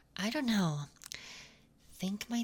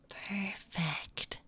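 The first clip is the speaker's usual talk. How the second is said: whispering. Acted. ASMR whisper.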